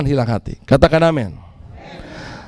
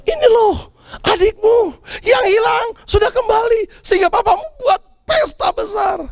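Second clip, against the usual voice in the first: excited servant; 11 semitones higher than usual. Retold or imitated. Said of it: "Hambanya bilang".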